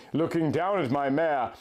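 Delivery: usual speaking voice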